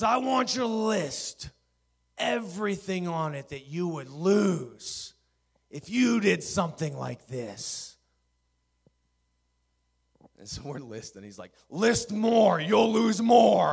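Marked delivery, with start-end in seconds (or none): 0.0-7.9 slow, loud, high mock-angry command; ripping into them. Chancellor lecturing student pastors, acted stern fury. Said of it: "just rips into us". 11.7-13.7 high shouted demand, stretched at the end; ripping into them. Retold or imitated. Chancellor pushing students to keep writing, imitated furious shout. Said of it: "just rips into us".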